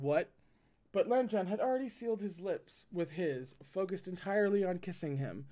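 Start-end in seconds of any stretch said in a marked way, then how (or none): none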